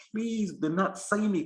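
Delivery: high, slow begging; acted plea. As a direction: implore as if you were the word. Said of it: "the word is like".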